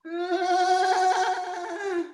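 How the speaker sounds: high pained little gasp; pained squeak. Retold or imitated. Beaten boy's high pained squeak. Defeated reaction after beating.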